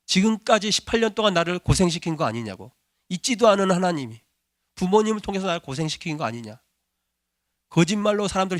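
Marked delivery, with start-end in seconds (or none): none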